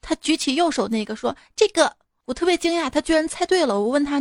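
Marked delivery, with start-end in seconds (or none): 1.6-2.0 put-on higher, bright child's voice; little girl. Daughter picking the cooked egg, bright child voice.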